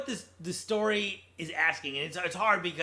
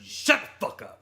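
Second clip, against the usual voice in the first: imitated curt command, mostly voiceless and forced out; punchy movie-line impression with the voice squeezed out; 3 semitones higher than usual. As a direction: mimic the movie hero's forceful, rasped put-down. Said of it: "the way he says it is so just pitch perfect".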